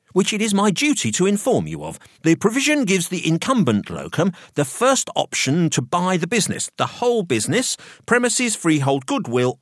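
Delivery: high-pitched, officious solicitor voice; professional solicitor. A narrator's reading. Solicitor explaining the will, officious professional mode. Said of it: "switched back into professional mode".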